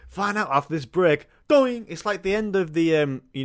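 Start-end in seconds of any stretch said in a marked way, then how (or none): 0.1-1.3 mock host line. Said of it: "It'd be like". 1.5-1.8 high, loud sung "doing" sting; mock sting. Imitating sting noise.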